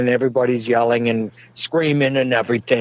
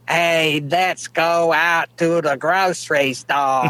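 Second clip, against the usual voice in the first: exaggerated Florida drawl, slow and higher; Southern drawl; 4 semitones higher, slower than usual. Jersey man mocking Florida locals' accent, long drawl. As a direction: stretch it out in a mock Southern drawl. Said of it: "they got an accent".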